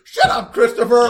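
high, loud, mocking yell; teasing the cohost with a mock shout. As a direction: bark it as a teasing impression. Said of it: "I could do this podcast as you".

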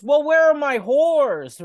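high, loud, slow indignant yell; mock angry shout. Russo yelling at the crew, hammed-up. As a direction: demand it like an outraged diva.

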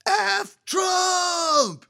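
high, drawn-out scream from farther off; screaming female voice. Retold or imitated. Woman answering from down below the deck, fainter scream. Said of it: "more faint but still screaming female voice".

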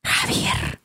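ghostly unvoiced whisper of a name; voice from beyond the grave. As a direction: breathe the name like a spirit from the grave. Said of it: "con una voz de ultratumba".

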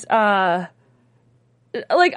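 ordinary talk, nothing marked

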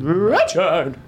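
odd name voice; weird drawn-out Richard. Pretend. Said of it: "saying the names weirdly".

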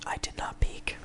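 hushed, unvoiced whisper; whispered denial. Real, not acted. Co-host denies peaking to the sound engineer, whispered denial. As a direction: whisper it as a small protest. Aside after being told he peaked.

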